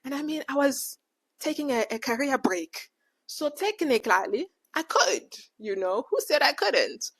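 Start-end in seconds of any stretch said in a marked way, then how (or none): none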